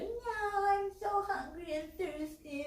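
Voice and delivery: put-on very high, slow childish wail; mock little-boy whine; whining. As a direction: drag it out like a whiny kid. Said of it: "he's whining, whining, whining to his mama".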